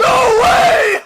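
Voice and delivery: high laughing scream; laughing shout. Real, not acted. Watching the touchdown replay.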